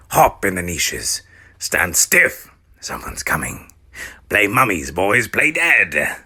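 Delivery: put-on urgent gravelly old mummy; hurried old guide, loud. Mounshroud's gruff character voice.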